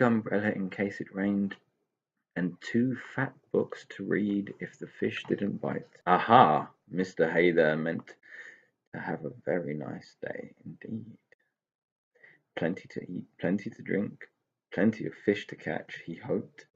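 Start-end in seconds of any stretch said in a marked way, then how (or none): none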